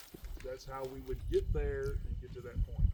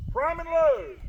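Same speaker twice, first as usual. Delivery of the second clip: loud, much higher bellowed order; shouted command; 13 semitones higher, 14 dB louder, slower than usual. Shown as a demonstration. Instructor showing the loading order to recruits, bellowed. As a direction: bellow it down the firing line. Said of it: "I'm gonna say".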